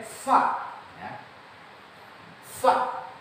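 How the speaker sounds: FA with airy f through the lips; a short fa, breath released on the f; correct letter sound. Shown as a demonstration. Teacher introducing the Arabic letter after go, correct fa. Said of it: "pengucapannya bukan begitu".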